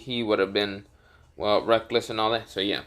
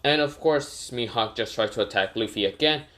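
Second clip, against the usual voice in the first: usual speaking voice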